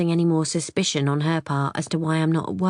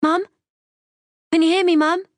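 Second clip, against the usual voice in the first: much higher, louder, calling into a bad line; desperate, raised voice on a failing call; 12 semitones higher, 6 dB louder, faster than usual. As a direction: shout down a breaking phone line. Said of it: "I say desperately".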